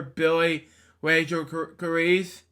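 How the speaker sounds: plain reading voice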